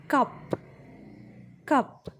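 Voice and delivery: teacher modelling "cup" with a short u vowel. Shown as a demonstration. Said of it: "So it finishes within less time only".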